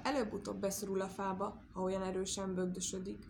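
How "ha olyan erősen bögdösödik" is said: usual speaking voice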